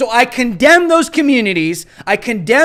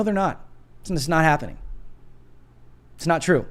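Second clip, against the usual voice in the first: ordinary talk, nothing marked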